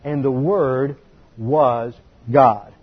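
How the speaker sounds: slow, weighty reading of the verse; slow quoted verse. Shown as a demonstration. Teacher reading the verse slowly to the class. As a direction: lay each word of the verse down with weight. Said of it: "then we have a phrase".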